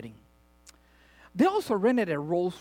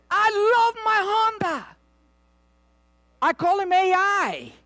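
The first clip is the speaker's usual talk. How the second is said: loud declaration; 14 semitones higher, 5 dB louder, slower than usual. Acted. Preacher declares love for car.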